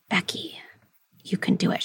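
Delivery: soft, breath-light self-encouragement; a quiet inner voice, coaxing gently; gentle inner voice. Acted. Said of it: "there's this other voice that says".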